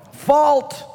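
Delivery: high, sharp referee call. Acted. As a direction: call it like a tennis umpire. Referee calling a tennis fault.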